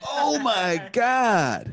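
high, long-drawn astonished exclamation; amazed. Real, not acted. Podcast co-host hears who the stranger was, amazed. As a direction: stretch it out slowly in high-pitched disbelief. Stunned by celebrity reveal.

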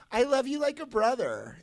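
high, sing-song friend-zone line; a hint of a gentle let-down girl; mock sweet rejection. Girl letting him down gently, mock sweet. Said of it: "walking up to me and going".

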